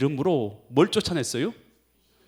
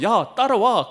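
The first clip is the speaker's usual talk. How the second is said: mock shout; 7 semitones higher, 7 dB louder, slower than usual. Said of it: "요한이요 가서".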